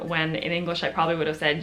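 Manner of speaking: ordinary talk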